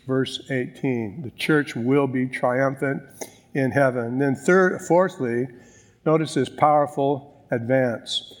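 ordinary talk, nothing marked

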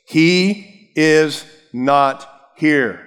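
each word spaced out and weighted; slow, emphatic. Shown as a demonstration. Preacher quotes the angel's message to the women, slow and emphatic. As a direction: deliver it slowly, word by word. Quoting the verse with deliberate weight.

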